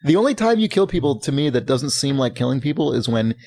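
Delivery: usual speaking voice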